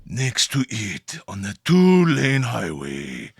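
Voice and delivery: very low, slow, hushed mystic; mysterious candle seller. Acted. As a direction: intone directions slowly, sinking deep and soft. NPC mysteriously giving directions.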